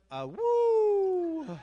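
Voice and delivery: high, loud, long "woo"; playful howl. Pretend. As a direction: let out a drawn-out howling woo. Howling out a slang word.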